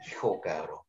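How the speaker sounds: breathy aside under the breath; a muttered whisper of a curse. Real, not acted. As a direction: whisper it to yourself in disbelief. Whispered aside after being booed.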